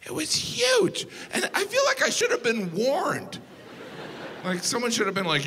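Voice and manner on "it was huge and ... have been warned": shocked outburst. Real, not acted. Comic to the crowd, a high anguished cry about the huge moose. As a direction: voice shoots up in a half-crying yell.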